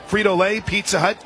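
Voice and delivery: usual speaking voice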